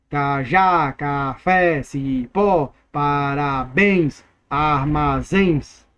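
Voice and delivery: "cajá, café, cipó, parabéns, armazéns" drawn out, falling on each last syllable; stretched stressed syllables. Shown as a demonstration. Teacher stretches stressed final syllables.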